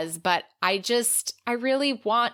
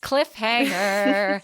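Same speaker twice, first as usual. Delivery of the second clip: dramatic cliffhanger; 3 dB louder, much slower than usual. Acted. Teasing the next episode.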